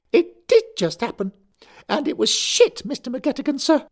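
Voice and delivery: high, loud, furious schoolboy; a choirboy spitting with rage; furious boy. A narrator's reading. Derek snapping at Larry, high furious schoolboy. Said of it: "dark with fury".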